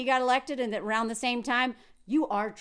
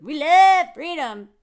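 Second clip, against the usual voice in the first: slow, louder mock proclamation; mock patriotic slogan; 8 dB louder than usual. Mocking American patriots, a hammed-up proclamation.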